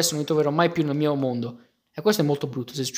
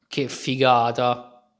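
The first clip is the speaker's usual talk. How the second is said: ordinary delivery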